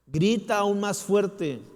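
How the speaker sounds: slow, loud, high rallying cry; urging shout. Real, not acted. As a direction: push every word out to the back row.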